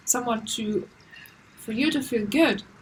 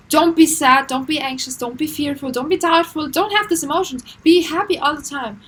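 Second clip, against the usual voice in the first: mock scolding; 3 semitones higher, 7 dB louder than usual. Harsh way to talk to a friend.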